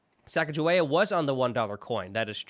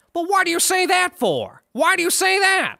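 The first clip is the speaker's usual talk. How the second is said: much higher, louder whiny cartoon voice; his voice; 14 semitones higher, 7 dB louder than usual. Friend imitating the ad character's voice, high whiny. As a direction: pitch it way up and whine the question. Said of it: "in his voice".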